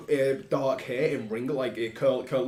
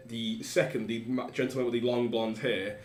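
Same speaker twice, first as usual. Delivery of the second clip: ordinary delivery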